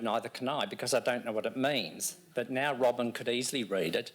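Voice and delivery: normal talking voice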